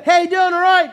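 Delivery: high, loud patronizing greeting. Retold or imitated. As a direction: talk to him as if he were deaf. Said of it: "People come up and go".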